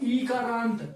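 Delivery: ईकारांत with the opening ई drawn out slowly; forceful long vowel. Shown as a demonstration. Teacher introducing the long-vowel term to students, stretching ईकारांत at the first ई. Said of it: "जोर से बोलना पड़ेगा थोड़ा".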